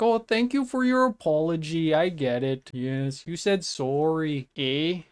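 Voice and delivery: hammed-up Canadian "eh?"; mock-nice MP voice; mock Canadian politeness. Imitating the nice MP.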